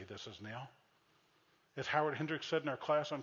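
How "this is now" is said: mumbled, much softer, flat "This is now"; flat deadpan. Retold or imitated. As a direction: drop "This is now" low and lifeless. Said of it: "he didn't say".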